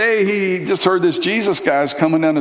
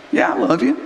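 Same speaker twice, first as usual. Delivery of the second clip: higher, eager reply; lifted, quick yes; Peter answering; 7 semitones higher than usual. Retold or imitated.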